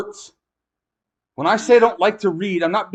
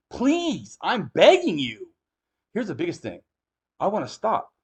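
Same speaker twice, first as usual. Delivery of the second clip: ordinary talk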